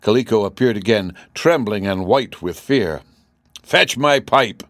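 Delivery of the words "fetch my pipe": slow, higher royal yell; irate king's raised-voice demand; yelled order. A narrator's reading. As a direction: yell it out one word at a time. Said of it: "yelled the king".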